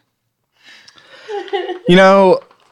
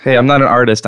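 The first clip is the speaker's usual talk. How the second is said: ordinary talk, nothing marked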